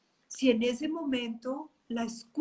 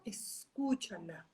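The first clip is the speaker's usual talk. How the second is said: slow, soft, close to the mic; soft invitation; 4 semitones lower, 8 dB quieter, slower than usual. Real, not acted. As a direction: say it gently, as an invitation. Invites audience to listen inwardly. (suave y lento)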